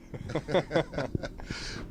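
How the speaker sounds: soft breathy chuckle; gentle, amused laugh under the breath; kind gentle laugh. Real, not acted. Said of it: "I'm laughing at the posing".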